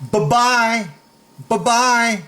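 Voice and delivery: high, repeated goodbye; dismissive send-off. Shown as a demonstration. Coach demos dismissing the bully, high send-off.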